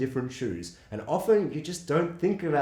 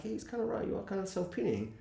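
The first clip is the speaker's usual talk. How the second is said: soft, higher inner-thought voice; hushed aside; 5 semitones higher, 11 dB quieter than usual. Retold or imitated. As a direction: slip it in quietly, like a private thought. Voicing own reaction while watching.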